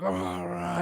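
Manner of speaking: Cyclops roar. Retold or imitated. Retelling the Cyclops starting to talk, mock monster roar. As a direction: imitate a monster trying to talk. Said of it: "Cyclops starts, like, talking".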